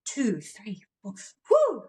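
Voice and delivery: sideline cheer rising to a whoo; a cheer mum counting then whooping from the sidelines. Pretend. Mum at her daughter's cheer practice, excited cheering.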